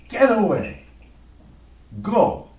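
loud, high shout to drive someone off; angry yell. Acted. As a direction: yell the beggar away. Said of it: "She yells at him".

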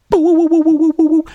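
very high, loud wobbling woo-woo; cartoon boing noise. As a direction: imitate something springing up and wobbling. Imitated pop-up sound effect.

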